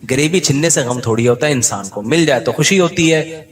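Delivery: ordinary talk, nothing marked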